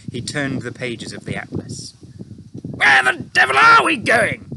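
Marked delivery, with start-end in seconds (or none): 2.6-4.5 high, loud, panicked officer; head of the Air Force crying out in alarm, panicked; frantic cry. A narrator's reading. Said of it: "he cried".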